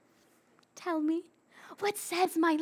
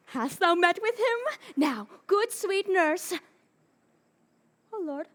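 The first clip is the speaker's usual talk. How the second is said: normal talking voice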